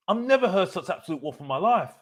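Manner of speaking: ordinary talk, nothing marked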